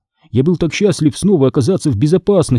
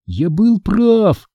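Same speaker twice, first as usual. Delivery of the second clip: ordinary delivery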